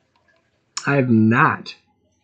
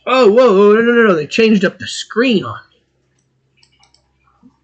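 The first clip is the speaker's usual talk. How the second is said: high, loud startled protest; sudden high-pitched alarm at a change; alarmed surprise; 8 semitones higher, 4 dB louder, slower than usual. Real, not acted. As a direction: burst out in dismay. Startled by changed screen layout.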